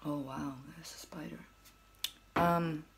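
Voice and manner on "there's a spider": hushed whisper at a spider; breathy hushed spider whisper. Real, not acted. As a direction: whisper so as not to scare it.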